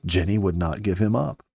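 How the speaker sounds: usual speaking voice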